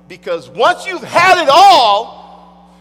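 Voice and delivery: thundering sermon peak; preaching climax. Acted. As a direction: drive the point home at full force.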